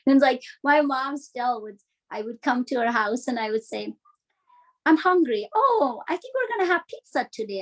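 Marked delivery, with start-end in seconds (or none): 4.9-7.6 a hint of a lively mom, higher; lightly voiced mother announcing pizza; mom's voice. Mom telling her daughter about pizza, lightly voiced higher.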